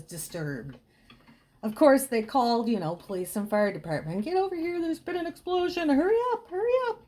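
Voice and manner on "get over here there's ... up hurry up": panicked. Retold or imitated. Panicked neighbors calling fire department.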